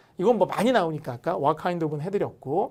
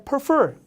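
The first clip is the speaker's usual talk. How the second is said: "prefer" drawn out slowly and louder, weight on the second syllable; stressed prefer; 8 semitones higher, 6 dB louder than usual. Shown as a demonstration. Teacher presenting "prefer" to the class, slow and stressed. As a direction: say "prefer" slowly, leaning on -fer. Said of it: "prefer라고 우리가 읽지 않고 prefer 이렇게".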